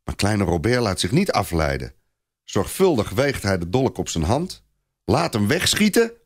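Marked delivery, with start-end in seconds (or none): none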